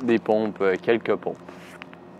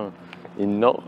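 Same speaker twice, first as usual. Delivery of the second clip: usual speaking voice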